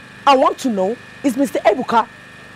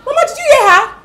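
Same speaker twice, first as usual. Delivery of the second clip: high, loud tearful pleading; loud, high whine to mother; whiny complaint; 6 semitones higher, 8 dB louder than usual. Acted. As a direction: beg mama to scold her sister. Daughter complains, demands mother act.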